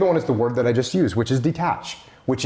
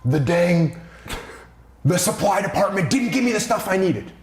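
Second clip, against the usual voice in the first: higher, frustrated complaint about supply; upset team member griping; complaining employee; 5 semitones higher than usual. Retold or imitated. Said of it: "you come to me and you say".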